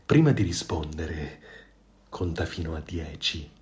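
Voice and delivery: low, soft, crafty advice; cunning tone. A narrator's reading. The fox slyly coaching the goose, soft and low. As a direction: whisper the trick like a schemer. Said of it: "suggerì in tono astuto".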